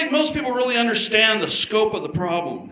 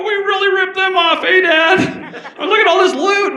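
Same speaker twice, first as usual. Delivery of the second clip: put-on excited kids; 9 semitones higher than usual. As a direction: crow over the loot like giddy children. Kids gloating over play money.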